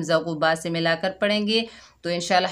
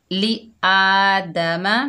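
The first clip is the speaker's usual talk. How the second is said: slow, drawn-out tajweed recitation; measured recitation demo; Quranic recitation; 4 dB louder, much slower than usual. Shown as a demonstration. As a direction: chant it slowly like a Quran teacher. Teacher recites the joined words.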